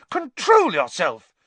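high, slow, loud scolding shriek; Madame Pumphrey shrieking. A narrator's reading. Matron ordering a professor to control himself, shrieking. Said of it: "shrieked Madame Pumphrey".

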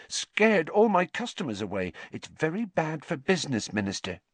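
high, edgy complaint; aggrieved landlady with an edge; slight edge. A narrator's reading. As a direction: press the grievance on the minister. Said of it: "a slight edge to her voice".

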